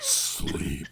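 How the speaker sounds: high eerie breathy command; demon voice. Acted. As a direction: lull him under like a demon. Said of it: "here's the voice calling him".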